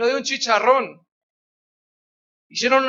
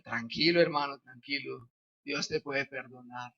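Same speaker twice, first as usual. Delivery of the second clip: much lower and softer, calming him; low hushed comfort for a weeping man; reassuring; 9 semitones lower, 10 dB quieter than usual. Retold or imitated. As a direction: soothe a broken friend gently. Comforting a crying friend.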